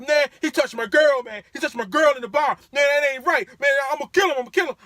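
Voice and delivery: put-on loud and high, shouting about his girl; furious drunk yelling. Drunk rider yelling that someone touched his girl, furious shout. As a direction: shout it, outraged. Angry drunk passenger ranting.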